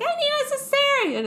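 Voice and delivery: very high, urgent mock plea; mock desperate Noomi. Noomi, pregnant with a squid baby, very high urgent plea. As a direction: beg for it up high.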